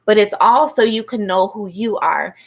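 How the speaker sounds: ordinary talk, nothing marked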